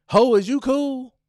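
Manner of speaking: high, loud brash challenge. Shown as a demonstration. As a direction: bark it out at him. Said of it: "repeats scripted line".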